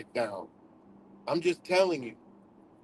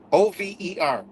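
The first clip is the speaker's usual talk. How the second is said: ordinary talk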